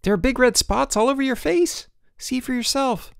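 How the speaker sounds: high-pitched urgent alarm. A narrator's reading. Boots telling George about red spots, alarmed. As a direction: exclaim it high, as if horrified.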